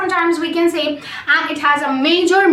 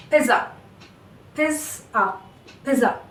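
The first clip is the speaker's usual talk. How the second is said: pizza with a short, soft schwa on the final A; unstressed final A; 5 dB quieter than usual. Shown as a demonstration. English teacher demonstrating the schwa in pizza, unstressed ending. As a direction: let the last A of pizza drop to a light "uh". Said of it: "not giving any stress on this last A".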